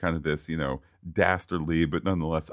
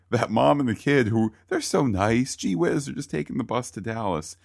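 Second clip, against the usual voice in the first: higher, sing-song mock innocence; mock sweet gushing; 6 semitones higher than usual. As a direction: gush over the nice family in a sugary voice. Mocking the nice bus family.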